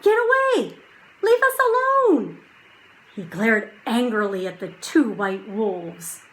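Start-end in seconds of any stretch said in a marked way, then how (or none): 0.0-2.4 high, loud furious yell; angry shout. A narrator's reading. Jack protecting Annie from wolves, loud angry shout. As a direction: drive them off with your voice. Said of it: "shouted Jack".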